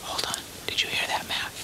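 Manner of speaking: whispering. Real, not acted. Whispering while listening in woods.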